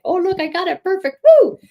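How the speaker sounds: high, loud cheer of delight; celebrating a perfect colour blend out loud; delighted whoop. Real, not acted. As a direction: whoop it up over a perfect stroke.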